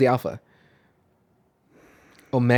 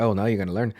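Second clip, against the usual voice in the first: put-on Irish accent; 6 semitones lower, 4 dB quieter, faster than usual. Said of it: "that was my Irish accent".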